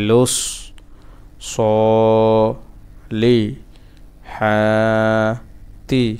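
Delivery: very slow, every syllable held apart. Shown as a demonstration. Teacher breaking a word down for learners, syllable by syllable. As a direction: stretch each one, then pause.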